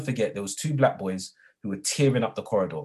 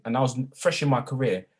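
ordinary talk, nothing marked

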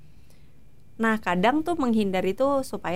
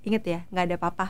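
low, calm reassurance; Adi; 3 semitones lower, 4 dB quieter, faster than usual. Retold or imitated. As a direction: soothe someone gently, voice lowered. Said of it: "dia cuma bilang gitu". (menenangkan dengan lembut, nada rendah)

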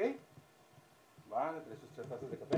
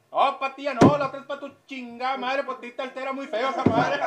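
mock insult; 9 semitones higher, 11 dB louder than usual. Waiter snapping back.

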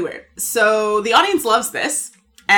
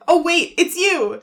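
mock reveal; 8 semitones higher, slower than usual. Corey's mocking reveal in retold scene.

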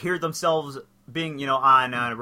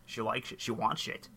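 low, soft film-star impression; Sean Connery; 6 semitones lower, 10 dB quieter than usual. Podcaster as the voice in his head, low celebrity impression. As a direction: play the star as an inner voice, quietly. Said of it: "It's me, Sean Connery".

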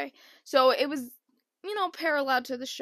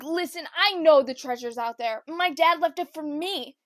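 mocking copy; 3 semitones higher, 6 dB louder than usual. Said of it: "I'm like".

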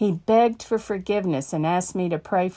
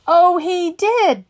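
mock disbelief; 11 semitones higher, 7 dB louder, slower than usual. Amused retort to death threat.

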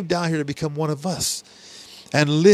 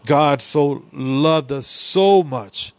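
"so" drawn out slowly and leaned on; slow right down and weigh every "so"; God so loved us, each "so" stretched; stress on so; much slower than usual. Shown as a demonstration. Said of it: "we need to emphasize the word "so".

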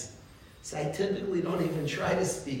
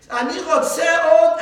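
high, loud shout over the teacher; screaming heckler; 11 semitones higher, 14 dB louder than usual. Retold or imitated. Students interrupting the shiur, screaming. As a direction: yell it out at full tilt. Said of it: "they're screaming at him".

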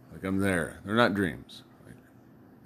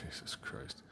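hushed, voiceless oath under the breath; retold shock, barely audible; whispered dismay; 18 dB quieter than usual. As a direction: whisper it in disbelief. Retold shock at father's confession.

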